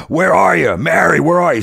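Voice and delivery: high, loud imitation of a searching wrestler; retold scream. Wrestler calls for the woman through the front door, imitated scream. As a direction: yell it out as if hunting for her.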